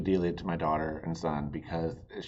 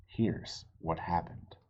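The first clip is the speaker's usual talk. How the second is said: ordinary delivery, nothing marked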